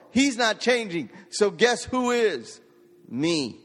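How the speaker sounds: high, loud preacher's proclamation; fiery preaching. Acted. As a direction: preach it out to the congregation. Preaching at full force.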